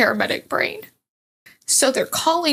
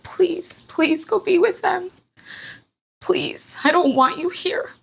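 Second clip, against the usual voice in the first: high, earnest retold plea; tearful pleading; 7 semitones higher than usual. Mother asking her husband to go be with the babies, pleading. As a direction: implore someone, voice lifted and close to tears.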